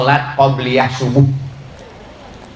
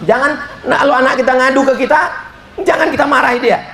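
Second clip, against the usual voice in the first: urgent appeal; 9 semitones higher, much faster than usual. Real, not acted.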